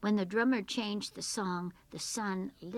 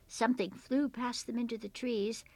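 ordinary delivery

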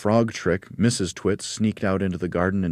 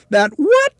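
very high, loud alarmed shriek; screaming; 12 dB louder than usual. A narrator's reading. Mrs. Twit hears of a giant creature, screaming. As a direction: shriek the question in horror. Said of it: "screamed Missus Twit".

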